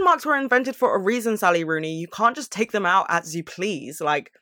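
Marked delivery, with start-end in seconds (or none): none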